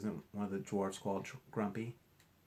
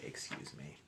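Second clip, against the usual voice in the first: polite quiet excuse me; 13 dB quieter than usual. Shown as a demonstration. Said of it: "you could say".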